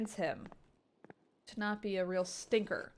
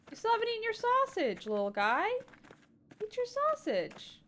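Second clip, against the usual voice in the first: high cooing voice to a pet; baby talk to a cat; 12 semitones higher than usual. Acted. Player coaxing the little cat to eat, high cooing. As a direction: coo it up high like talking to a kitten.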